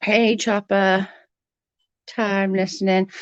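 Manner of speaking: normal talking voice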